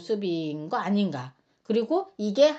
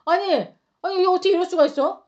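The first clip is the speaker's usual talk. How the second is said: mock outrage; 6 semitones higher, 4 dB louder than usual. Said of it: "너무 공감되지 않습니까".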